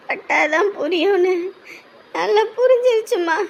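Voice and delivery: words squeezed out between sobs; bitter tearful resignation; crying. Acted. Weeping over the family's betrayal.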